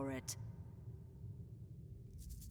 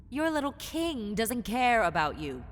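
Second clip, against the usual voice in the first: loud scorn; 4 semitones higher, 9 dB louder than usual. Acted.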